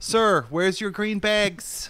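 put-on high, slow, loud kid voice; mock ten-year-old yelling. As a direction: pester a stranger like a hollering child.

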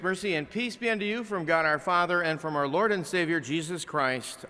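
ordinary talk

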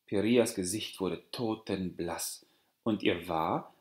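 ordinary delivery

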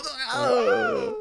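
high-pitched mock scream; imitated cry. Mimicking people headshot from miles away, high mock scream. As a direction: mimic a far-off victim's high yelp. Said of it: "and they go".

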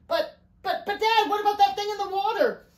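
high, stammering alarm; panicked question. A narrator's reading. Riley asks Dad about the thing outside, panicked question. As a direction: blurt out a frightened protest. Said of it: "Riley asked".

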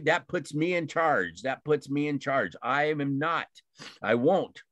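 usual speaking voice

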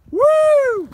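loud high whoop of thrill; excited whoop. Real, not acted. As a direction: let out a wild cheer. Cold plunge, whooping.

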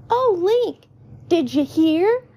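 put-on high, excited kid voice; excited child, pitched high. A narrator's reading. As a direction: burst in with big news for a friend. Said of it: "Tallow says excitedly".

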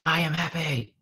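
lightly voiced small child; imitating his young daughter. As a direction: echo it back as a little girl would. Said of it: "And she goes".

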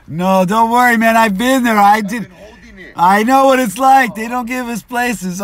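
very high, very loud comforting shout; loud reassurance. Real, not acted. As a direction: reassure him at the top of the voice. Reassuring the peeing man loudly.

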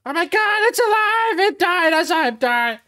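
very high, loud, panicked shrieking, slowing at the end; a terrified groundhog losing it; freaking out. Acted. Game master voicing the panicking groundhog, shrill high loud terror. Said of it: "The groundhog's freaking out".

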